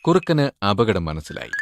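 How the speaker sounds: plain reading voice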